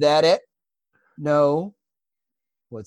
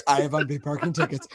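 movie quote. Retold or imitated. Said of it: "he pops up in the glove box".

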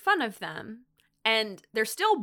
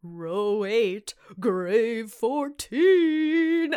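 spooky storyteller; 3 semitones higher, 5 dB louder, much slower than usual. Retold or imitated. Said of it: "he goes".

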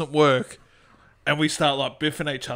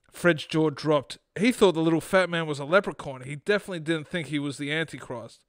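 story narration; 5 dB quieter than usual. Acted. Podcast host reads his short story to co-host, storytelling. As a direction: read the short story aloud, composed.

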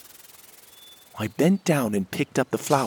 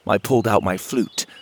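usual speaking voice

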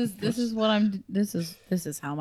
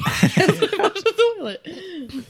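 laughing; 10 semitones higher, 5 dB louder, much slower than usual. Real, not acted. Laughing at bathroom noise.

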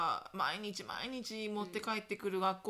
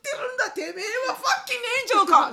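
loud, high, fast outraged rant through laughter; laughing fury; 11 semitones higher, 14 dB louder than usual. Retold or imitated. Mother rants at the meddling mom, loud and laughing. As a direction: blow up, half laughing. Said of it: "みたいな".

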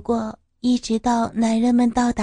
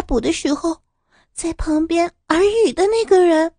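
urgent excited; 6 semitones higher than usual. Acted.

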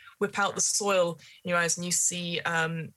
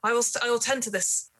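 higher, urgent servant voice; 6 semitones higher than usual. Acted. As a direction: push the lady out, pleading. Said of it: "the servant is like".

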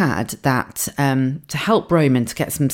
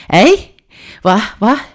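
higher, louder puzzled "Eh? What, what?"; confused deaf mum; 6 semitones higher, 5 dB louder, slower than usual. Retold or imitated. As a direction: play a mum who can't hear a thing. Deaf mum not hearing.

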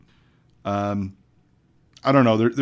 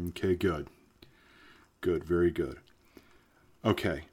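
hypnotist voice; 3 semitones lower, 7 dB quieter than usual. Acted. Leads a hypnosis induction.

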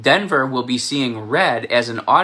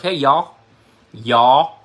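New York accent; 2 semitones higher, 6 dB louder, much slower than usual. Shown as a demonstration. Showing how New Yorkers say it, mock New York accent. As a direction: say "y'all" like a New Yorker, the L nearly gone. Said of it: "Or as we would say in New York".

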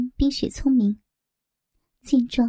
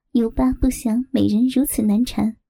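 usual speaking voice

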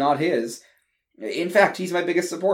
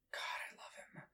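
whispered; 25 dB quieter than usual. Acted. Aside about loving brother.

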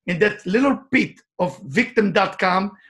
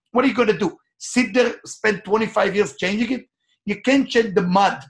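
ordinary talk, nothing marked

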